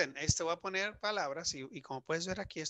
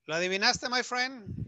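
cheery check-in; 9 semitones higher, 8 dB louder than usual. Real, not acted. Teacher checks the student.

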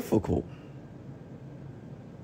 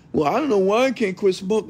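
higher, put-on whiny voice for the excuse; mock whiny smoker; 9 semitones higher than usual. Mocking a smoker to the live audience, whiny put-on voice. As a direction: mimic a helpless smoker making excuses.

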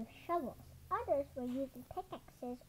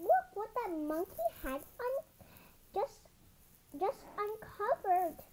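excited; 7 semitones higher than usual. Acted. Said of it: "one cried".

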